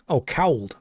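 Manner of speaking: "cowled" with the L sounded, rhyming with "howled"; deliberately mangle "could" into "cowled"; mock mispronunciation. Shown as a demonstration. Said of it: "How do you say could".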